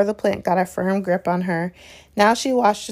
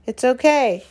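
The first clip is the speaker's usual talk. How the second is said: calling up; 4 semitones higher, 3 dB louder than usual. Acted. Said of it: "he called up to the light".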